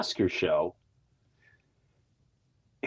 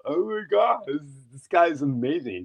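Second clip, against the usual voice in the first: mock clueless fan; 3 semitones higher than usual. Host imitates people with no clue, mock gushing voice. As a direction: imitate a clueless admirer, pitch dropping after the first gasp. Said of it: "people that have no clue".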